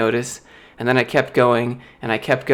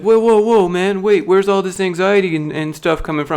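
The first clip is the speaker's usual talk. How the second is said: mock alarmed boss; 6 semitones higher than usual. Boss startled as he announces he's quitting, mock alarm. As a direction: imitate the boss jumping back in alarm.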